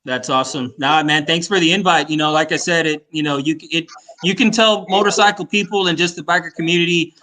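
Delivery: ordinary talk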